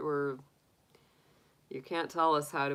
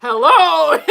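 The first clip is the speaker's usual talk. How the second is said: laughing hello; 11 semitones higher, 17 dB louder than usual. Real, not acted. Excited greeting with laughter.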